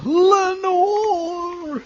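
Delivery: very high, long wailing call; calling out a name. Pretend. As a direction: wail the name into the phone.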